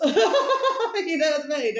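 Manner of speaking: loud high laugh through the words, slowed; words stretched out by a big laugh; cracking up while asking. Real, not acted. Laughing after friend's quote.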